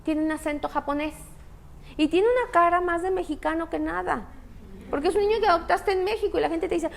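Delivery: normal talking voice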